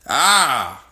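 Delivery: ordinary talk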